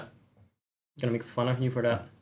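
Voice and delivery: ordinary talk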